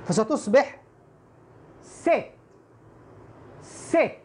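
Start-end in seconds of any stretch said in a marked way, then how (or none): 1.8-4.1 ست said "set", with a kasra-like e; short, higher ست with an "e" vowel; kasra pronunciation. Shown as a demonstration. Teacher models "set" after explaining kasra, short e. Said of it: "تنطق مكسورة".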